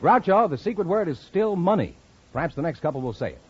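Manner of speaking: ordinary delivery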